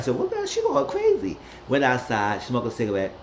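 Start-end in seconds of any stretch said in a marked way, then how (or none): 0.2-1.4 sudden squeaky high-pitched aside; high gossipy mock voice. Everybody whispering about Lulu, in a high squeaky gossip voice.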